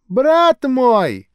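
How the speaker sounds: high, loud cry of a street preacher; fervent loud greeting; shouting old woman. A narrator's reading. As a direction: call out to a stranger with zeal. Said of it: "закричала она".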